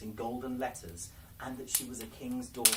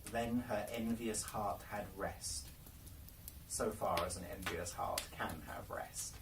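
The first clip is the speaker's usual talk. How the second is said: plain reading voice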